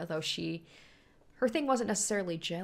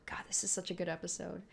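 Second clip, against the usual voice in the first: soft sigh; 2 semitones lower, 10 dB quieter, faster than usual. Real, not acted. Moved by episode.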